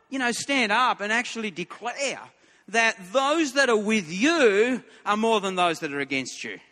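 ordinary delivery

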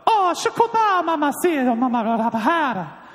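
very high-pitched babble; mock foreign gibberish. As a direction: gabble made-up words way up high. Fake foreign gibberish prank, acted.

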